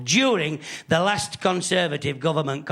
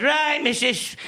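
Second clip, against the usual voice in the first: higher-pitched official threat; stern warning, pitched up for show; bluffing gas man; 6 semitones higher than usual. Acted. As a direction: bluff it out as an indignant bill collector. Acting a gas man threatening the householder.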